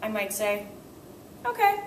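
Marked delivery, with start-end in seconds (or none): 1.4-1.8 accepting okay. Shown as a demonstration. Teacher accepts flawed speech.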